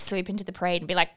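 usual speaking voice

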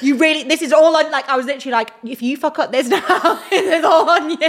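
loud, laughing retold warning. Retelling her warning to Gracie, laughing loudly. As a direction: laugh through the quote. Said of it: "I was like".

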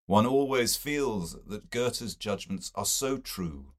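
plain reading voice

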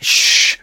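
unvoiced shushing hiss; retold shush. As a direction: hush him with a sharp "shh". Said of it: "I'm like".

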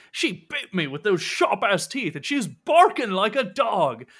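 high, agitated pirate protest; bitten sailor's complaint. Acted. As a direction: cry out in high-pitched outrage. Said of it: "Cutthroat says".

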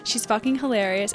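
normal talking voice

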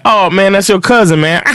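high, amused imitation of the uncle; uncle's answer. Storyteller voicing his uncle's amused reply, pitched high. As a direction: toss it off with a laugh.

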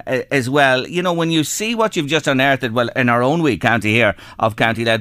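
ordinary delivery

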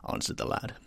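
ordinary delivery

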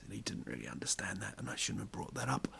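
soft guilty whispered aside; whispering. Real, not acted. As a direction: whisper it like a confession. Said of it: "whispered aside".

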